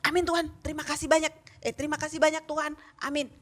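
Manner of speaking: fast, hurried gabbled prayer; child hurrying a prayer to go fly kites, fast; rushed prayer. Retold or imitated. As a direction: rattle it off to go play. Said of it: "Lalu dia berdoa".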